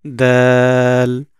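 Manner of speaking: "দাল" held long on a level note; drawn-out letter name. Shown as a demonstration. Teacher names the letter shown, "দাল" drawn out long. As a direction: stretch "দাল" slowly and firmly. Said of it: "এরকম".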